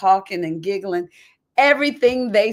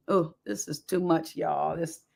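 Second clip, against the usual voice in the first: tearing up; 5 semitones lower, 8 dB quieter than usual. Real, not acted. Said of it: "tearing and stuff".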